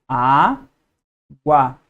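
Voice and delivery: "Água" said carefully on its own, stressing the first syllable; slow careful classroom reading of "Água"; syllable demo. Shown as a demonstration. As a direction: model "Água" clearly for the class. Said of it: "Duas sílabas".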